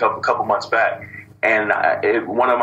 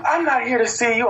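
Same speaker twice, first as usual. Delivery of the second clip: mock lady; 13 semitones higher than usual.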